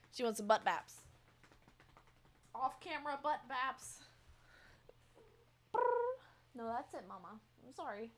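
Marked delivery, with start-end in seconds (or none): none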